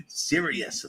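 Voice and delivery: breathy whispered aside. Acted. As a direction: drop to a whisper. Whispered aside after laugh.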